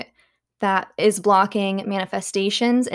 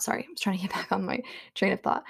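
quick whisper; 9 dB quieter, faster than usual. Real, not acted. Losing her train of thought.

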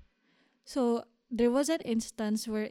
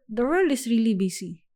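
ordinary delivery

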